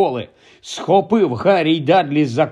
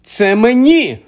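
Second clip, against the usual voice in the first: loud, higher cry of protest; shout; 8 semitones higher, 5 dB louder, slower than usual. Acted. Harry shouting at uncle Vernon over the letter, loud cry. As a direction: claim it loudly as yours. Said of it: "крикнув Гаррі".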